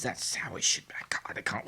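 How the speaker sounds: hushed mock shock; mock stunned whisper. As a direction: whisper like the world just broke.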